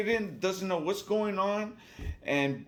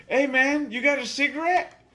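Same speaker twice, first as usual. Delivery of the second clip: higher-pitched mock begging; pestering drunk guy; 7 semitones higher, 3 dB louder than usual. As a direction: imitate a guy cadging a smoke. Retold pestering drunk asking for cigarette.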